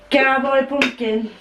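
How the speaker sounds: cowboy pumpkin said slowly in a mild Yorkshire accent; a hint of Yorkshire, drawn out; light Yorkshire accent. Shown as a demonstration. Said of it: "I didn't really Yorkshire then".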